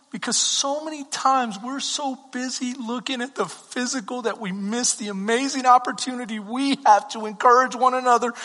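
usual speaking voice

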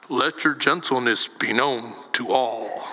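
very low, solemn scripture voice; mock formal reading. Shown as a demonstration. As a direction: intone it deep, like stilted old-fashioned speech. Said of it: "we don't talk like that".

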